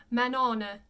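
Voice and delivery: Manon as "man-ON-uh", n sounded; unnasalized Manon. Shown as a demonstration. Teacher shows the hypothetical final E, no nasalization. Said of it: "you wouldn't nasalize that either".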